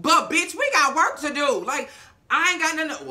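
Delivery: loud, high mock protest; acted-out indignant outburst; heated confrontation. Imagined confrontation as Issa.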